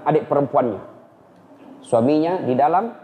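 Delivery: usual speaking voice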